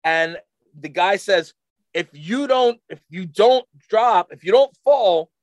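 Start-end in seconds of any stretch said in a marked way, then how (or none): 1.9-5.3 higher, emphatic imitation of a warning; retold stern warning. Guy warning skier about the fence, emphatic raised warning. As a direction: hammer out the threat, raised voice.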